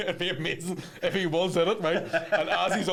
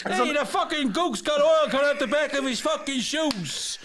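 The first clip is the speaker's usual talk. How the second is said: high, brash New York tough guy; Joe Pesci impression; 10 semitones higher, slower than usual. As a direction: snarl like a wiseguy, pitched up. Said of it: "Joe Pesci in The Goonies".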